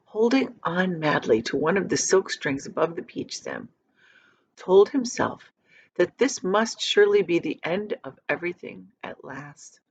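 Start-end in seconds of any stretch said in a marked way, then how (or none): none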